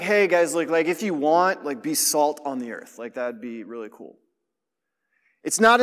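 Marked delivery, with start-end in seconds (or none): 0.0-4.2 limp, shrugging, much softer offhand tone; lazy "if you want" voice, trailing much quieter; mock casual suggestion. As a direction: make the command sound like an optional favour, mockingly. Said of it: "this isn't a suggestion".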